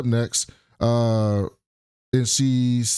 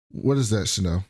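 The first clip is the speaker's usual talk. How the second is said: ordinary talk, nothing marked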